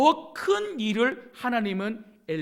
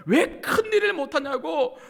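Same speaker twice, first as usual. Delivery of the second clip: very loud, high mock rebuke; acted-out angry dressing-down; scolding; 7 semitones higher, 9 dB louder than usual. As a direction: hammer out each accusing question louder. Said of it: "이렇게 혼내시는 분이 아니세요".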